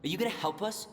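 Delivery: angry challenge. Acted. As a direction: confront the chief head-on, pushing back.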